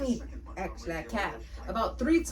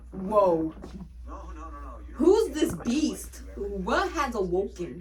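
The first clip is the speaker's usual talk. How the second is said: mock awed alarm; 2 semitones lower, 3 dB louder, much slower than usual. Shocked at coworker's sudden switch.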